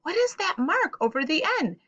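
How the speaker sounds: put-on a light puzzled kid asking; curious student voice. Teacher voicing a puzzled student's question, lightly curious. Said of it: "and you're saying".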